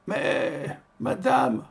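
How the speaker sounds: reedy, high-pitched protest; shrill little old man. A narrator's reading. Old organist protesting to the baker, shrill and high. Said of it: "protesta l'organe aigu d'un petit vieillard".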